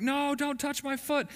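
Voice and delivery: high, whiny imitation of a screaming brother; mock scream. Brother refusing mom's help with the bee sting, mock scream. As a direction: pitch it way up without real volume. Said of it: "And he's screaming".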